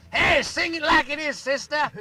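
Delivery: a high, loud, slow holler; a heckler's playful shout to the singer; imitating the audience heckler. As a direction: call out from the crowd in mock jive. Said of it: "stands up and says".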